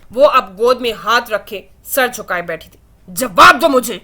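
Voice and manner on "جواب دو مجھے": loud angry demand; loud growl, demanding an answer. Acted. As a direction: bark the order at her. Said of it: "حدید جیسے غرایا تھا".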